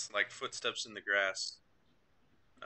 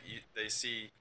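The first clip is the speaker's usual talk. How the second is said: normal talking voice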